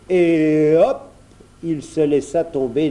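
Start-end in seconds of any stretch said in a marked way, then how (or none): 0.1-1.0 loud, drawn-out leap call; jumping cry. A narrator's reading. Bear leaps into the well, calling out. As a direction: launch yourself with the word, pitch swooping up.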